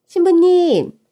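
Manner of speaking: bright loud call to the bride. Acted. Shop staff calling the bride over, loud.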